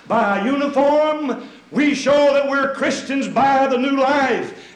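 loud, high pulpit climax, slowing on the new life; rising loud sermon peak; full-force preaching. Acted. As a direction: drive it home to the pews.

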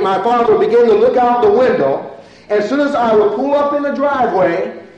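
high, driving preacher's storytelling; preach. Real, not acted.

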